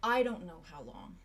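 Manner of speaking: soft, resigned admission; quiet grim confession. Acted. Silas telling Arlo he may be dying, quiet and resigned. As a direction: let it sink heavy.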